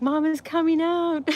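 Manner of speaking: high, alarmed call to mom, retold for laughs; mock panic. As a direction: imitate the panicking son, pitched high. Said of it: "he started panicking".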